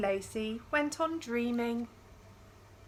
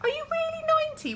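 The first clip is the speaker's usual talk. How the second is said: put-on much higher, fast child's question; little girl; 5 dB louder, much faster than usual. Emily, four and a half, asking her great-great-grandma. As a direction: ask it like a small curious girl. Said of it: "whispered Emily".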